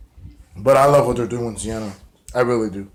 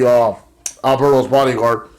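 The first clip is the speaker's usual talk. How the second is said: ordinary delivery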